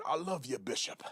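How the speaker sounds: soft, hushed mock pastor persona; softly put-on pulpit sincerity; fake pastor. Mocking pastors in front of the church, soft fake-pastor voice. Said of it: "they have a pastor persona".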